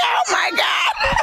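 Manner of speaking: high, slow, laughing oh my God; mock disbelief. Reacting to TV advice against distraction, high laughing disbelief. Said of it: "I'm like".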